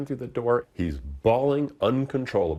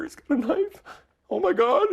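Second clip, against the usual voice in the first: retold panic; 5 dB louder than usual. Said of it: "he starts screaming".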